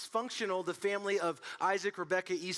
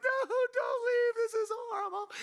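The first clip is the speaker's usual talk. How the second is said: put-on high wailing kid plea; mock a sobbing little boy begging; little brother crying as big brother leaves home; imitating crying little brother; 13 semitones higher than usual. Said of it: "bawling, crying, like".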